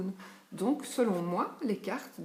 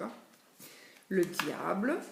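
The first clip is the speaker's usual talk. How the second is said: ordinary talk, nothing marked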